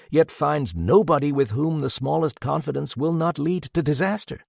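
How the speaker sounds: plain reading voice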